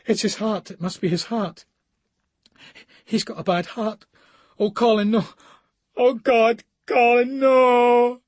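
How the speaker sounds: high, loud, frantic lament; panicked wailing. Acted. Woman wailing over her collapsed man Colin, high panicked cries. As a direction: plead with fate, voice climbing in panic.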